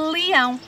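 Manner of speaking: "leão" with the opening l held; stretched initial sound. Shown as a demonstration. Teacher matching first sounds for children, "leão" with held l. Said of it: "começa pelo mesmo som".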